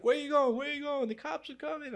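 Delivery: high-pitched urgent holler, loud at first; calling after someone. Retold or imitated. Train driver to the kid running off, urgent high call. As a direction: imitate a man yelling after a runner.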